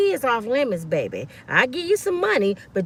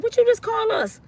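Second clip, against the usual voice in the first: indignant demand; 9 semitones higher than usual. Retold or imitated. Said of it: "I went off on his".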